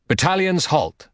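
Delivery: a general halting his battalions; calling out. Acted. Hector halts the Trojan battalions, calling out high.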